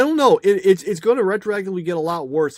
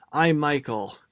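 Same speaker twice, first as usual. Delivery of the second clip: normal talking voice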